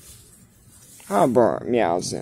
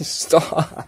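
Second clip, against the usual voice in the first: laughing stop; 5 semitones higher, 3 dB louder than usual. Real, not acted. Laughing while cat scratches.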